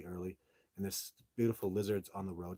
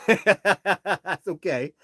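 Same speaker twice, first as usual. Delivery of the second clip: laughing it off; 9 semitones higher, 13 dB louder, much slower than usual. Real, not acted. Laughs at his own remark.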